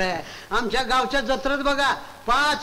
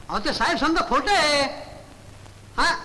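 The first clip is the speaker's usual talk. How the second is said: ordinary delivery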